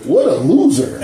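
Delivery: lightly voiced high mocking ex; mocking ex-girlfriend. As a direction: pitch up to mock him as the ex. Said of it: "she was like".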